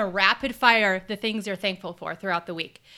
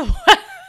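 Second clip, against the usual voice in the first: high burst of laughter; 12 semitones higher, 10 dB louder than usual. Real, not acted. Laugh at the retake.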